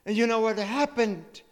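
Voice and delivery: high, loud and slow preacher's climax; fervent sermon peak, each word held; preaching at full force. Acted. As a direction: proclaim it slowly to the whole congregation.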